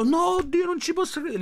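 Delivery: high-pitched mimicked "oh my God" outburst; mock cry of disbelief. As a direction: imitate an overwhelmed woman crying out. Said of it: "invece lei subito ha avuto sta reazione".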